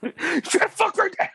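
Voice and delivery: very high hammed-up tough-guy challenge; mock angry challenge. Hothead squaring up to someone, very high hammed-up challenge. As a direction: push the mock threat up high.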